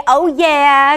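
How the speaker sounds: loud, high cheer for the audience; hyped-up cheer. Pretend. Host cueing viewers for the next pairing, hyped cheer.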